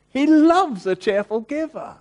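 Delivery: a joyful, lifted cry; joyful exclamation. Real, not acted. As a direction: burst out with delight on "loves". Restating the verse with joy.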